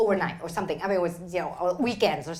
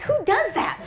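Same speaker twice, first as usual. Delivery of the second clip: mother's retort; 14 semitones higher, 5 dB louder than usual. Retold or imitated. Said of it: "She's like".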